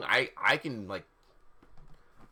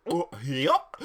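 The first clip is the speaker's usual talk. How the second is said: cartoon goofball voice; Goofy; 3 dB louder than usual. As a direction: put on a silly cartoon yokel. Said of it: "Hagrid's voice was Har-, uh, Goofy".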